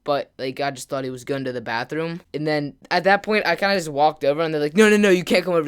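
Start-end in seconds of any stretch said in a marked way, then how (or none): 4.8-5.7 loud, hurried mock protest to keep someone away; urgent warding off. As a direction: imitate friends blocking someone, higher and louder. Friends stop him from coming near.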